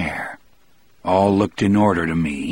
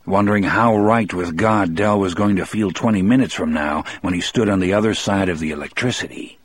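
plain reading voice

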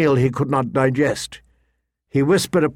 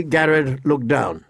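plain reading voice

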